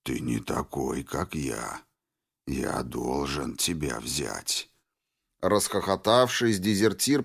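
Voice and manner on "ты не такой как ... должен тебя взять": deep, rasping mutant voice, slow; slow low monster drone; hoarse bass. Acted. Mutant keeps talking to deserter.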